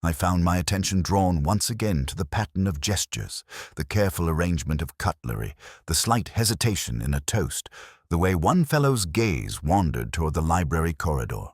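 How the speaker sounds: usual speaking voice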